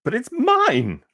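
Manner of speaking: high, loud slow scream. Acted. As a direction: scream it like a jealous bird. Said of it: "screamed the bird".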